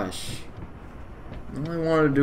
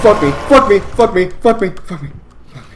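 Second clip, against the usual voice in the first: loud frantic swearing; panicked cursing; 4 semitones higher, 10 dB louder, faster than usual. Real, not acted. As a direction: curse in a panic.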